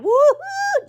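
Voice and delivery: very high, far, cheering woo-hoo; excited woo-hoo. Real, not acted. Crafter cheering her bow sticking, high woo-hoo. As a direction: sing out a gleeful woo-hoo. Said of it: "See how excited I am".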